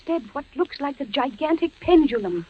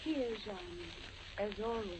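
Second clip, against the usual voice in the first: very soft, distant reply; soft ghostly answer; 5 semitones lower, 18 dB quieter, faster than usual. Acted. Beatrice answering Jean from far away, very soft.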